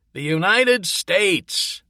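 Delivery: almost yell it at him; nearly shouting. Acted. Sergeant corrects Lewis about the president, nearly shouting. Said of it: "nearly shouted".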